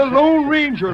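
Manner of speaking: thrilled exclamation; excited reveal. Acted. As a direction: burst out the name. Revealing famous owner.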